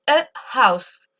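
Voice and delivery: house with its H clearly breathed out; slow demo, audible H in house; H pronounced. Shown as a demonstration. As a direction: sound the H at the start of house. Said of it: "je prononce le H".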